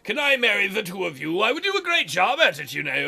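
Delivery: theatrical dragon voice with swooping pitch; chatty, delighted dragon. Acted. Dragon offering to wed the eloping pair, delighted. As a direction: gush grandly, swooping up and down.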